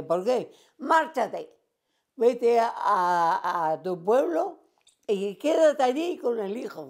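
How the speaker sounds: high, insistent urging; telling a friend to go home to her village. Retold or imitated. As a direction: push her to leave now.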